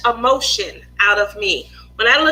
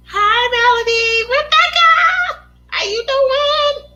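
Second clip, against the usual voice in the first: high, sing-song hello, loud on the name; excited greeting; 11 semitones higher, 4 dB louder than usual. Real, not acted. Streamer greeting viewers as they join, excited. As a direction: call out to a friend, pitched way up.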